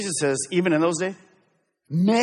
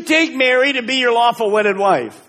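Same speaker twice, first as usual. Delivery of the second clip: lightly voiced wedding minister, higher and louder; officiating preacher; 8 semitones higher, 6 dB louder than usual. Pastor marrying the couple, mock solemn vow.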